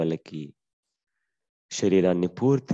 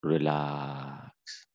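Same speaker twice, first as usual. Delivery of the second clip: Relax much softer and lower, trailing away; fading relax cue; 3 semitones lower, 6 dB quieter, much slower than usual. Shown as a demonstration. Teacher softly repeating relax, fading into silence. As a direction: let relax sink to near silence. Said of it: "softer repeat".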